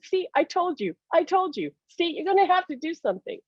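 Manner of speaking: high, insistent husband; imitated I-told-you-so. Husband says he was proved right.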